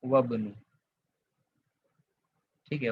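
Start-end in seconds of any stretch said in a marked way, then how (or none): 0.0-0.5 "वब्नु" joined with no i, falling; linked reading. Shown as a demonstration. Teacher repeating the answer for students, "वब्नु" linked without the i. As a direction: say "वब्नु" as a single linked word. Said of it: "क्या पढ़ेंगे इसको यहाँ पे?".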